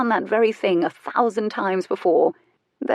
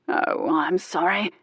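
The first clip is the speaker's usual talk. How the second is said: husky; slower than usual. A narrator's reading. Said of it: "His voice was husky".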